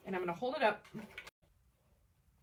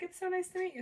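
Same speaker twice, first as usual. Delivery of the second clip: high gushing fan; 10 semitones higher, faster than usual. Retold or imitated. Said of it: "She's like".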